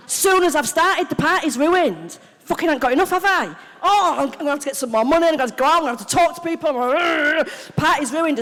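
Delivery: loud, high, agitated ranting; rising panic. Acted. Addict finding there isn't enough to use, panicking. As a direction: wail it in mounting comic panic. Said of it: "there starts to be a panic".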